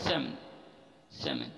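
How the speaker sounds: "सैमन" with the L silent; correct pronunciation. Shown as a demonstration. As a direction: say "सैमन" with no L sound. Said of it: "ना कि सालमन". ("सैमन" में L की आवाज़ नहीं)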